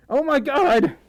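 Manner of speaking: high, loud gasp of astonishment; shocked exclamation. Real, not acted. Streamer seeing a donation arrive, shocked cry.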